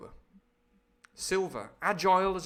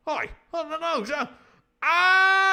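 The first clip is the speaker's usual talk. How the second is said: high-pitched alarm into a loud scream; mock death cry; 14 semitones higher, 11 dB louder than usual. Man killed mid-chat, mock screaming. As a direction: break off into a hammed-up scream.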